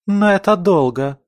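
high-pitched, contained outcry; crying out. A narrator's reading. Camilla objects to waiting until autumn, crying out. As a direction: protest in a mild shout, voice jumping up. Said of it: "закричала Камилла".

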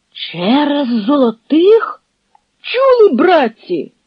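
high, incredulous shout; outraged disbelief. A narrator's reading. A Cossack repeats the bribe to the crowd in high disbelief. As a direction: stun the crowd with the news.

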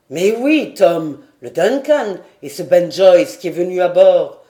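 loud, emphatic insistence; impatient insistence. A narrator's reading. Lord reminding sailor of his own yacht, impatient. Glenarvan presses the baffled sailor.

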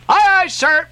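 high, eager call back; cheerful cry. A narrator's reading. Little King Post answering his captain, cheerful cry. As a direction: answer the order brightly, high-pitched. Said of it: "cheerfully cried little King Post".

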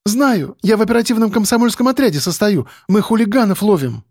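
put-on high, brisk girl's voice; high-pitched earnest young woman; eager young activist. A narrator's reading. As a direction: insist proudly, high and quick. Girl proudly answers editor.

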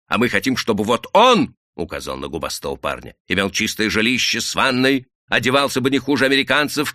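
громче и выше, напористо; говори повысив голос, убеждая; повышенный голос. Acted. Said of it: "Рабочий повысил голос". (рабочий громко убеждает мужика)

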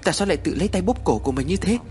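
high, worried questioning; anxious husband urging his wife to answer; alarmed concern. A narrator's reading. Husband alarmed at wife's self-choking.

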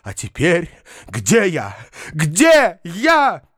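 high-pitched theatrical lament; melodramatic cry. A narrator's reading. Foma remembers embracing mankind, wailing dramatically. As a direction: wail it, then slow for the final questions. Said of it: "кричал Фома".

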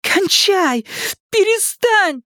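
high-pitched cries to stop; frantic protest. A narrator's reading. Daughter struggles with father over packet, frantic protest. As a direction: beg him to quit, urgently.

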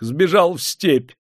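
high, anxious informer; servile, eager high voice; fawning frightened clerk. A narrator's reading. Frightened clerk pleads, informing on servant.

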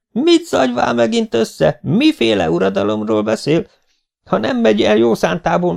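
high-pitched, indignant scolding; felháborodott asszony. Acted. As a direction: cut him off with a threat. Acted quarrel, woman threatens to call police. (magas hangú, felháborodott rendreutasítás)

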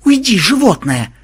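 loud, high, irritated bark; barked order. A narrator's reading. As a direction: snap at a pesky animal. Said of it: "рявкнул Ксенобайт". (раздражённый рявк)

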